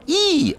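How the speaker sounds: very high ee vowel; high squeaky ee. Shown as a demonstration. Showing the mouth sound, high ee. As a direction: stretch a high ee. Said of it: "when I would go".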